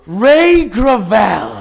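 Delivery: huge drawn-out high shout; hammed-up imitation of a thrilled fan; star-struck cry. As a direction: bellow the idol's name in delighted awe, stretching it out. Star-struck actor greets idol.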